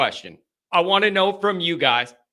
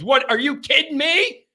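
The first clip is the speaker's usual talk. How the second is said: retold disbelief, pitched sharply higher; incredulous outburst; 8 semitones higher than usual. Host retelling his reaction to a streaming error, incredulous. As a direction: react in indignant disbelief, rising high on the question.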